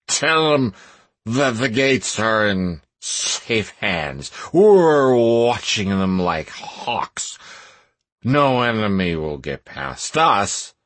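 put-on slow, slurred drunk guard; drunken mumble. A narrator's reading. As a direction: slur it like a tipsy guard. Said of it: "mumble in a drunken voice".

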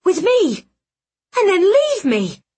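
distraught outcry. Acted. Betrayed heroine confronting Magnus.

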